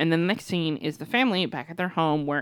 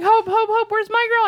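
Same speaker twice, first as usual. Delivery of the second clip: very high, excited cat voice; Sassy; 6 dB louder than usual. Acted. Cat calling for Hope, eager. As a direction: call eagerly for your girl. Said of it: "And, like, she's like".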